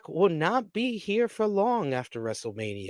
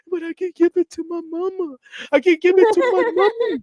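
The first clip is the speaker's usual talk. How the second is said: mocking baby talk; 6 dB louder, faster than usual. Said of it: "he says".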